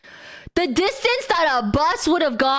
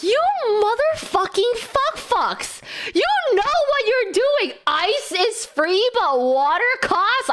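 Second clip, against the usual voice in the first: mock fury; 9 semitones higher than usual. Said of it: "I'm like".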